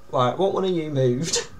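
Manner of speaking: ordinary delivery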